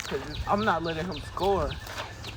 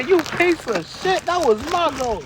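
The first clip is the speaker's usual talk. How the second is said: outraged yell; 10 semitones higher than usual. Acted. Striker yelling at the teammate who stole his goal, outraged.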